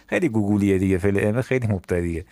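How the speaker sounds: usual speaking voice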